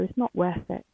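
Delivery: ordinary talk, nothing marked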